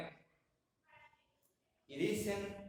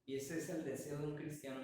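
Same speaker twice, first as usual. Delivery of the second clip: normal talking voice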